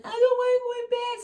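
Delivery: put-on high, loud, slow little-girl protest; toddler whine. Toddler protesting to mum at bedtime, in a high slow whine.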